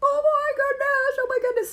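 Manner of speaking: thrilled at the giant gift store; awestruck. Retold or imitated. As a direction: gasp it out in wonder. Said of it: "I was just like".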